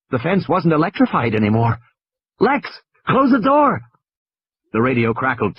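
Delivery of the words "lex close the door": high, slow, urgent command; urgent boy. Acted. High, urgent boy's voice. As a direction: Tim urges Lex to close the door. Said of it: "Tim urges his sister".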